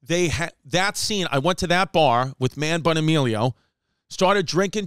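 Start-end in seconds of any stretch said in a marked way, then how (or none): none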